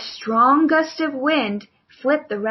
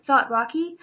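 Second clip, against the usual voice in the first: plain reading voice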